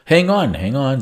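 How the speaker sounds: sharp high 'hang on', then calmer; sudden alarm. Real, not acted. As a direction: stop yourself short, alarmed. Sudden realization mid puzzle.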